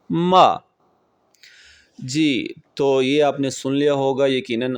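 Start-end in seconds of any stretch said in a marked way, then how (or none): 0.1-0.6 मा starts high and loud, then drops sharply; falling tone demo. Shown as a demonstration. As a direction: throw मा down from a high pitch. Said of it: "इसे एक मरतबा फिर से सुन लीजिए". (मा का सुर ऊँचे से तेज़ी से नीचे गिरे)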